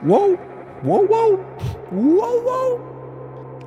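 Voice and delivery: high sliding whoa whoa whoa; classic whoa call. Pretend. Reading aloud, mimicking the comic's classic whoa cry. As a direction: wail each whoa high and long. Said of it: "here's the classic".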